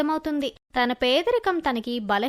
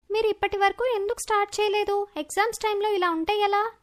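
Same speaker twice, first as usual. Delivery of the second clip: put-on high, quick girl voice; concerned girl, pitched up; questioning schoolgirl; 6 semitones higher, faster than usual. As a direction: chide classmates in a higher young voice. Sumana questions classmates.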